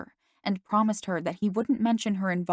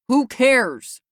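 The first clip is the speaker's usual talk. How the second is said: loud, high impatient outburst; 5 semitones higher, 9 dB louder than usual. A narrator's reading. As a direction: cut in impatiently. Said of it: "Dad cut in impatiently".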